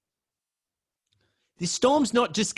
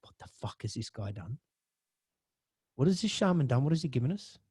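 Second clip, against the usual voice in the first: quiet inner thought; 5 semitones lower, 7 dB quieter, faster than usual. Retold or imitated. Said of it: "I'm thinking".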